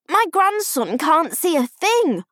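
loud, high, slow complaint; irritated theatre-goer; annoyed grandfather. A narrator's reading. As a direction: grumble loudly, stretching the words. Annoyed man behind complains.

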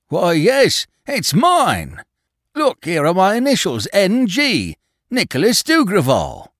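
high, loud startled exclamation. A narrator's reading. Owner is shown his lost watch, startled. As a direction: burst out in excited recognition. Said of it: "Dougreval gave a start".

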